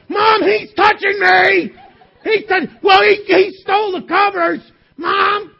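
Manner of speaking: high, loud shouted whine to mom; whiny tattling little sibling. Acted. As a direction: yell for mom like a tattling kid. Kid calling mom to tattle.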